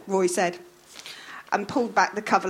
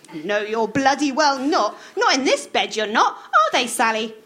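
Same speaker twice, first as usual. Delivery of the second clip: higher, louder scolding wife; bossy wife snapping at Roy; Maud scolding; 4 semitones higher, 4 dB louder than usual. A narrator's reading. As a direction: nag him to hurry up. Voicing Maud.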